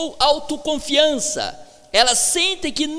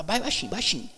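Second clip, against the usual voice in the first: lower, softer aside; imitated hushed urging; 6 semitones lower, 6 dB quieter than usual. Choir neighbour at his side urging less volume, hushed and low. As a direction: quote a neighbour's discreet hushing. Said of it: "do meu lado assim".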